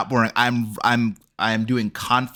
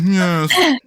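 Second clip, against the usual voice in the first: higher, louder affected vampire voice; elf vampire impression; 8 semitones higher, 6 dB louder than usual. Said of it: "He's like".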